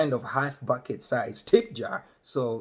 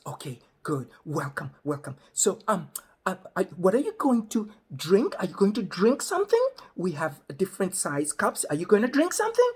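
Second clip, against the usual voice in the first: over-explaining lady; 7 semitones higher than usual. Retold or imitated. Counter lady over-explaining slowly.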